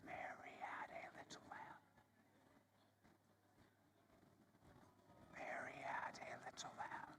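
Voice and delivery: very soft unvoiced whisper; just breath, no voice at all. Shown as a demonstration. Teacher whispering along as students try it.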